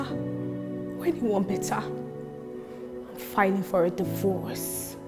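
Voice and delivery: soft, slow final blow; quiet and slow. Acted. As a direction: drop to a slow hush. After the outburst.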